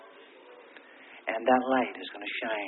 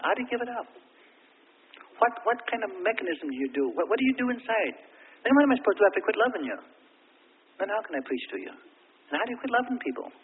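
high, loud pleading preacher's cry; impassioned preaching; 11 semitones higher, 6 dB louder than usual. Real, not acted. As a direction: pour out the question to the congregation. Preaching climax, rising questions.

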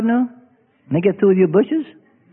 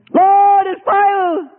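panicked cry; 10 semitones higher, much slower than usual. Acted. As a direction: cry out from the flames. Believer in the fire.

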